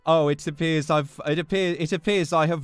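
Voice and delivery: American friends mocking a British accent; bad British accent. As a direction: ham up a fake British accent. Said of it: "in their fucking shitty British accents".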